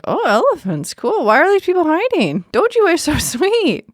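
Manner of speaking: high-pitched, mock-naive excitement; oblivious delight. Retelling clueless tourists meeting aggressive elephants, oblivious delight.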